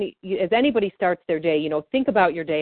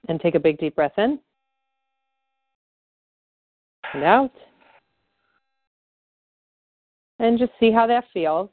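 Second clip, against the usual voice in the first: guided breathing cue; 6 semitones higher than usual. Acted. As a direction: breathe in and out with them, then check in.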